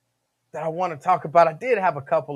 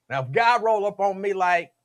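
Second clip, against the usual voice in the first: ordinary talk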